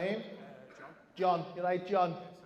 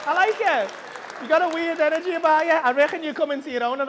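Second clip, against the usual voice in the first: high, loud burst of amusement; delighted teasing; 12 semitones higher, 10 dB louder than usual. Real, not acted. Delighted teasing of audience member.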